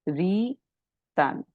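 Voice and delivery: रीतन drawn out slowly, a long री, then तन set apart; pronunciation demo. Shown as a demonstration. Teacher showing students how the symbol is pronounced, रीतन slow and split. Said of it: "इसको कैसे pronounce करेंगे".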